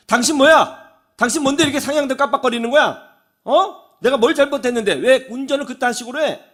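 acted angry yell, high and loud; retold angry yelling. Driver confronting the car behind, angry yelling. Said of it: "소리를 지릅니다".

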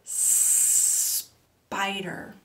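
SPIDER on a very long hissed S; long snake sound. Shown as a demonstration. Teacher invites children to join in, long S. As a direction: hold the S of spider for others to join. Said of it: "say it with me".

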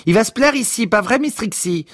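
very high, elated voice; delighted calling out. Acted. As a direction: call over to her in high delight. Delighted boss calls to Miss Trixie.

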